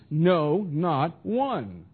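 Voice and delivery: NO hit loud, then a separate "not one"; double emphasis. Shown as a demonstration. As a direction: land hard on "No," then "not one" apart. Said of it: "here we have the double emphasis".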